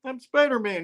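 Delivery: high-pitched, flustered self-defence; mock indignant protest. Spidey denying he is a pervert, mock indignant.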